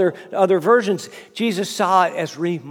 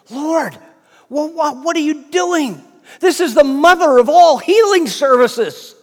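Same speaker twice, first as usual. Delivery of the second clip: high, incredulous Peter, louder at the end; protesting Peter; 6 semitones higher, 5 dB louder than usual. Retold or imitated. Disciple Peter protesting to Jesus, incredulous. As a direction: Peter can't believe they're leaving. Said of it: "Can't you just hear Peter say".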